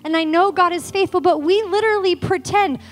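breaking down. Retold or imitated. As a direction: pour out the grief, rising. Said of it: "I'm just breaking down".

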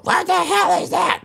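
high shrill-free falsetto wife voice, slow; wife's outraged question. Retold or imitated. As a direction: squeak it out as the shocked wife. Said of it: "Your wife would smell it and go".

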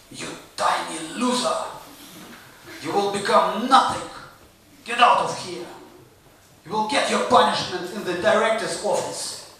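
lightly acted stern teacher; scolding teacher. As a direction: scold a pupil with mild mock severity. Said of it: "and even teachers".

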